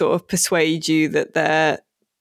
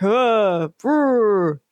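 high, drawn-out mock crusader cry; mock zealot cry; 4 semitones higher, much slower than usual. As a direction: ham up a preachy Templar. Templars voiced mocking battle cry.

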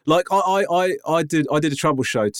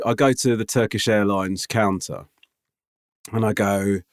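ordinary delivery

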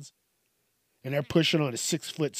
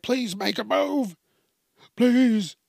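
high, pleading mock cry; mock begging; 8 semitones higher, 3 dB louder, slower than usual. Onlookers begging the player to move, high pleading. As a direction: beg him like a terrified onlooker.

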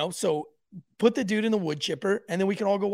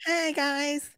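mock greeting voice; 9 semitones higher, 5 dB louder than usual. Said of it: "I feel like that's what everybody says".

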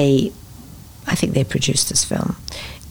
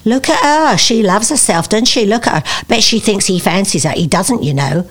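higher, chatty Cockney gossip; Cockney accent; 3 semitones higher than usual. Retold or imitated. Schoolgirl remarking on teacher's love interest, Cockney accent. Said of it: "I had acquired a Cockney accent".

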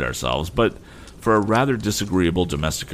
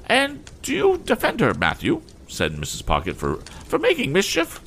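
much higher, outraged lady; indignant lady. Acted. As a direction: accuse him in a high huff. Mrs Pocket accuses.

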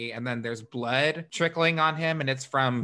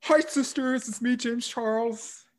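high, put-on YouTuber greeting; James Charles impression; 10 semitones higher than usual. Host doing James Charles for her cohost, high chirpy greeting. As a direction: chirp a beauty-vlogger hello, pitched way up. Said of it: "Not James".

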